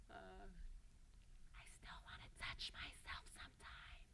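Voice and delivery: hushed, secretive whisper; confessional whisper. Acted. Child's secret confession in monologue.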